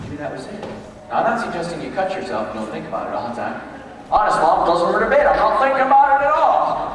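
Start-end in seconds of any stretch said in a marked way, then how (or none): 4.1-7.0 put-on loud, higher, insistent kid voice; fake brave kid. Kid assuring Mom it doesn't hurt, loud and higher. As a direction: protest too much to Mom.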